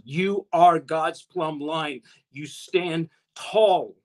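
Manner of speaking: slow, weighty stress on each word of "You are God's plumb line"; emphatic exhortation. Real, not acted. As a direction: draw out "You stand tall" slowly and firmly. Preacher exhorts listeners with key point.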